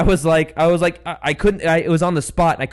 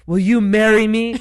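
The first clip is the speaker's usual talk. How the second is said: loud, slow, raised-pitch proposal; mock proposal; 6 semitones higher, 6 dB louder, slower than usual. Man on his knees to an artichoke, mock proposal. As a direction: propose grandly for all to hear.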